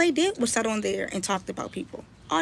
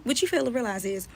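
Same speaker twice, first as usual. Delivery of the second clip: normal talking voice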